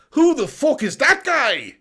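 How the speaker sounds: put-on high, loud, drawn-out Irish accent; Conor McGregor impression. Streamer as the fighter taunting a rival, high brash Irish accent. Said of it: "Conor McGregor".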